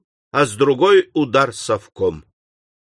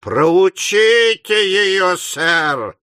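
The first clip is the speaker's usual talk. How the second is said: higher, louder, slow and drawn out; even louder shout; 5 semitones higher, much slower than usual. A narrator's reading. Slurk daring Pott, shouting louder still. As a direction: bellow the dare. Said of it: "ещё громче крикнул Слерк".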